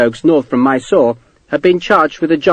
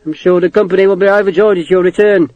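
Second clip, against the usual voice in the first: laconic; 7 semitones higher, 4 dB louder than usual. A narrator's reading. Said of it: "Morris said laconically".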